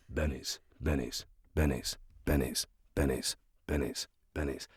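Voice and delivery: low, soft, identical repeats with even gaps; mic test repetition. Retold or imitated. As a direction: repeat it evenly like a dutiful sound check. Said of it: "go across the mic in two-inch intervals and say the word Bennett's".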